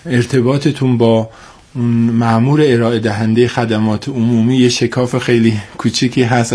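usual speaking voice

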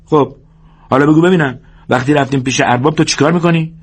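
high-pitched bossy minder laying down rules; George, instructing. Acted. George drills Lennie on meeting the boss, insistent.

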